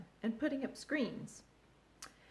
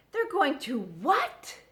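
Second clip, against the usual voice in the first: louder, slow disbelieving cry; screaming; 7 semitones higher, 8 dB louder, slower than usual. A narrator's reading. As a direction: shriek it out in shock. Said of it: "screamed Wilbur".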